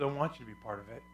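soft, low, quick line voicing God; God's gentle invitation. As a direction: drop the volume for a quiet invitation. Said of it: "but he says".

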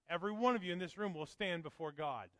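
loud, high, ringing sermon voice; fiery pulpit preaching; full-force preaching. Acted. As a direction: preach it out to the back row.

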